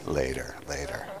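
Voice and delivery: understated, low-voiced dismissal; quiet put-off. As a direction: drop it low and soft, putting someone off. Retold teacher's dismissive answer to request.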